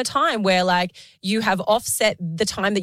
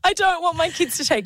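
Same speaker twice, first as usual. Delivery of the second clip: laughing; 9 semitones higher than usual. Real, not acted. Laughing while emotional.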